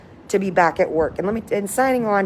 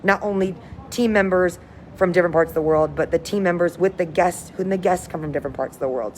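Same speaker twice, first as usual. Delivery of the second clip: ordinary delivery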